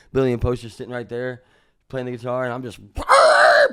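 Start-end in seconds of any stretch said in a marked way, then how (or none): 2.9-3.7 loud, drawn-out "wah" sound effect; imitated chair-yank noise. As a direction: shout out a long noise for the seat. Imitated noise of pulling seat.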